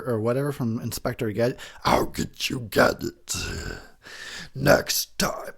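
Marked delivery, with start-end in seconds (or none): 1.8-5.5 raspy whispered cartoon villain; cartoon villain threatening his nemesis in a rasp; Doctor Claw impression. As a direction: hiss it like a scheming cartoon villain. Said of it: "is that the voice of, uh, the, the Doctor whatever".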